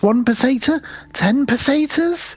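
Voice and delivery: high, slow pleading; pleading beggar. Retold or imitated. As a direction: haggle pitifully. Beggar bargaining, voiced high.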